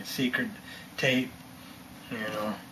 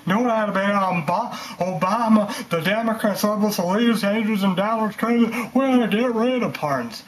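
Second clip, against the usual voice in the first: pitched higher and louder, bragging hard; hammed-up political boast, higher and louder; mock politician boasting; 6 semitones higher, 4 dB louder than usual. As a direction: play a cocky leader promising big. Acted boastful politician quote.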